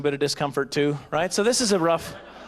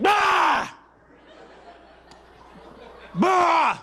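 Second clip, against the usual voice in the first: sudden startling yell; 13 semitones higher than usual. Acted. Husband scaring hiccups from the unborn baby, sudden high shout.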